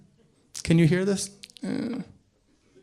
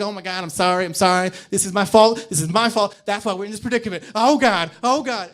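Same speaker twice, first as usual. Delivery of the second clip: higher, louder, frantic pleading to someone who isn't there; frantic apology; 4 semitones higher, 7 dB louder than usual. Retold or imitated. Crazy homeless person losing an argument with nobody, frantic. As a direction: act out a panicked imaginary apology. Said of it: "They're like".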